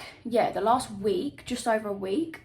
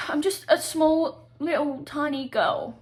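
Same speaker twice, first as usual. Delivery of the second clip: mock little girl; 6 semitones higher, 5 dB louder, slower than usual. Self-pitying joke about being alone.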